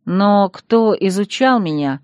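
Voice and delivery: usual speaking voice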